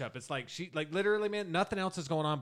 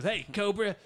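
higher, light put-on partner voice; partner impression; 7 semitones higher, 4 dB louder, slower than usual. Podcaster imitating the partner greeting Cobra, lightly put-on voice. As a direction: greet him in a mock sidekick voice. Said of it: "I'm doing the same voice from that other guy".

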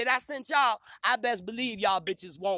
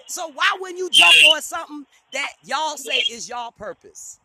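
loud, exasperated shout at the members; angry shout; 4 semitones higher, 11 dB louder than usual. Real, not acted. Ranting at her organization, a loud angry shout. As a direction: yell it out in frustration.

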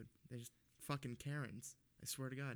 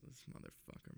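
under the breath; 12 dB quieter than usual. Retold or imitated. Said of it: "'cause I'm whispering under my breath".

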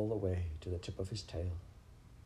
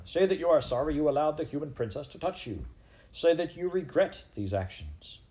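raised, quick, insistent commands; demanding repentance; 7 semitones higher, 3 dB louder than usual. A narrator's reading. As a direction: press the accused hard to confess, pitched up. Head mouse demands the accused repent.

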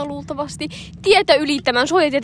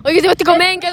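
raised, louder traffic yell; higher, impatient mock shout; imitated shouting; 4 semitones higher, 5 dB louder, faster than usual. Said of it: "mä huuan ihan täysin".

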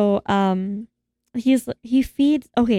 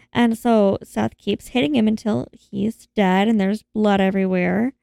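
ordinary talk

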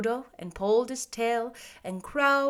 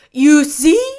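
loud, high, assured straw man; confident scarecrow; 6 semitones higher, 16 dB louder than usual. A narrator's reading. Scarecrow explaining himself to the girl, confident. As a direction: begin with bold confidence. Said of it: "he continued confidently".

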